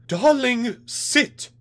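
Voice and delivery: terrified, menacing scream, very high. Acted. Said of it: "his wife screamed out".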